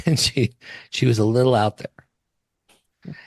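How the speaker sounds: slow, laughing words; still laughing. Real, not acted. Telling the funeral story, still laughing. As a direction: let the laugh carry the line. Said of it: "I lost it".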